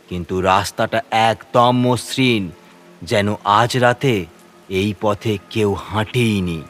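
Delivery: plain reading voice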